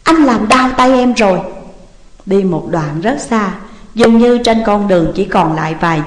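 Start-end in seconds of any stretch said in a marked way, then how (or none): 0.0-1.4 higher, pained protest; narrated cry of hurt protest. A narrator's reading. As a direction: complain as if dragged by the arm. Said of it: "vừa chạy theo vừa kêu lên".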